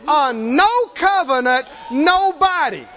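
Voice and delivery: slow, high, emphatic shout; scornful proclamation. Retold or imitated. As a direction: proclaim it like a defiant challenge, stretching each word. Preacher hammers home David's scorn.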